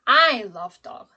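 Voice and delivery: loud, stretched "I", quick tail; stress on I. Shown as a demonstration. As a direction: punch the first word, "I". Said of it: "it's on I".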